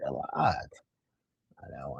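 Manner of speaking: breathy aside under the breath; whispered aside to the chat. Real, not acted. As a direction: drop to a whisper for a second. Aside during game commentary.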